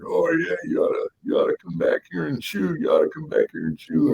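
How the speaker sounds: high-pitched mock peer-pressure coaxing; goading older kids. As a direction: coax him like a cocky upperclassman. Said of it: "you know, guys back there".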